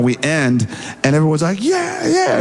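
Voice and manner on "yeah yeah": high-pitched mock shout; imitated cheering. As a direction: whoop like an excited band. Voices the band cheering.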